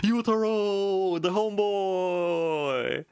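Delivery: high, slow, stretched-out announcer call; drawn-out hype call. Pretend. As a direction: stretch the name out like a ring announcer. Hyping up a character's entrance.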